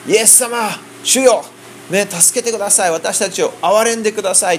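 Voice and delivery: a hint of lepers crying out for mercy; pleading cry. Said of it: "って言って叫んできたんです". (高めの声で、軽く叫ぶように懇願)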